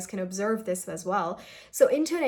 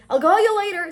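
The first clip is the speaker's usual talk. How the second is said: "I'll call you later" shouted higher and louder across a distance; 10 semitones higher, 10 dB louder than usual. Shown as a demonstration. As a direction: call it out to someone far away. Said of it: "if somebody is way too far from you".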